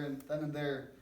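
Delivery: ordinary delivery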